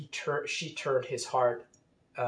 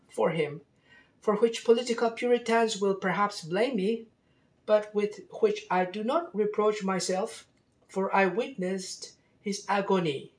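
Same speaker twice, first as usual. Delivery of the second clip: put-on French accent; 9 semitones higher than usual. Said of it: "with a French accent".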